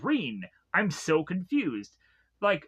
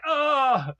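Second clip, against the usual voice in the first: high, hammed-up teary outburst; mock emotional gush; 7 semitones higher than usual. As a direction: overdo being moved, jokingly. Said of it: "I'm like".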